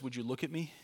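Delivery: put-on soft, tender coaxing to a child; a hint of a father holding a kid's face; gentle father's plea. Father coaxing his toddler to meet his eyes, softly tender. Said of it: "takes the face of a kid and says".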